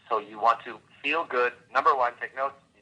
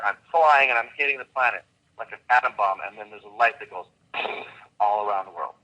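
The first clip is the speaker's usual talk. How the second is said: ordinary talk, nothing marked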